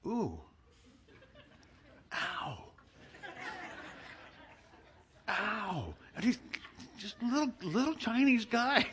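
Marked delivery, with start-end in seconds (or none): 0.1-5.9 mock pained ows. Massage starts hurting, retold.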